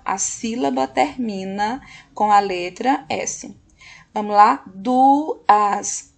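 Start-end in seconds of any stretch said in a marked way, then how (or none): 4.7-6.1 DUAS loudly split as DU... AS; teacher separating DU from AS; syllable by syllable. Shown as a demonstration. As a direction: sound out DUAS in two stretched beats. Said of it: "a segunda sílaba".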